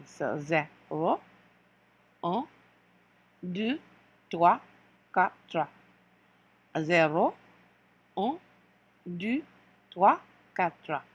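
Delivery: slow French count, numbers spaced out; French count to four. Shown as a demonstration. Teacher leading the class through zero to four in French, slow. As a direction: count zero to quatre slowly. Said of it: "zero to four again".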